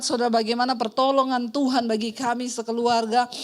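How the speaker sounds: ordinary talk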